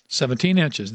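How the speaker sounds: ordinary delivery, nothing marked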